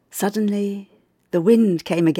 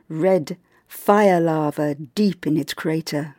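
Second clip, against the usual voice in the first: usual speaking voice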